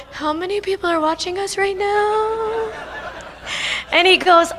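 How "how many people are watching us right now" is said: higher, softer nervous aside; hushed aside. Retold or imitated. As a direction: lean in and ask under your breath. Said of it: "And I go".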